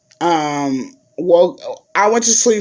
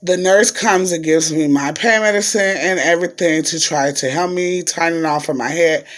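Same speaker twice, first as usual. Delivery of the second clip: normal talking voice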